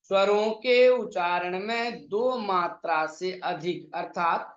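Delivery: ordinary talk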